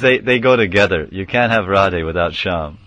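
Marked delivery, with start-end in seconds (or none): none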